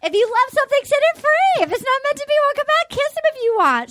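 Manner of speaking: high, singsong-bright pep talk; mock magnanimous. Tammy urging her rival on, overdone generosity.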